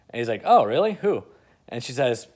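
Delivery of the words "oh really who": surprised. Retold or imitated. Bruce asking Lois who she dates, surprised. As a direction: pitch jumps up, louder on oh really.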